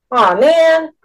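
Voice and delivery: whiny moan of protest. A narrator's reading. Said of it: "Joe moaned".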